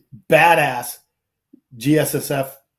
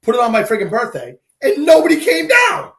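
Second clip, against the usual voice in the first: mock outrage; 5 semitones higher than usual. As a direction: work yourself up into a hammed-up shout. Retells his own mock outrage.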